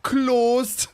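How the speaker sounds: high, loud, hammed-up Northern English accent; British accent. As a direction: wail it in broad Northern English. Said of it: "my favorite British sentence is".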